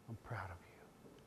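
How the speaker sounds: father whispering to his son; whispered praise. Real, not acted. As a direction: lean in and whisper it. Father repeats praise, hushed.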